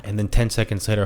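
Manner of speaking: normal talking voice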